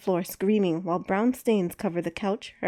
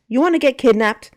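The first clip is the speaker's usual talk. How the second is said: loud, higher furious yell; angry shout; 5 semitones higher, 9 dB louder than usual. A narrator's reading. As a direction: yell it in her face. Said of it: "he barked".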